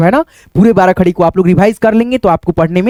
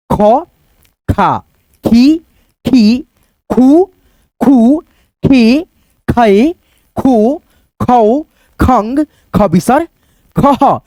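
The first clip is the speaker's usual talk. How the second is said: each syllable said alone with a short pause after it; syllable recitation; much slower than usual. Shown as a demonstration. Teacher chanting the barakhadi to students, one syllable at a time. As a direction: recite slowly for children to repeat.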